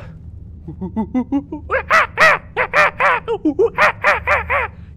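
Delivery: high hooting chimp whoops; chimp screech. Acted. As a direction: screech like a bonobo. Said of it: "a bonobo chimp".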